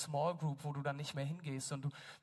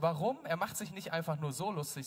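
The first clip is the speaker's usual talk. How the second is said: ordinary delivery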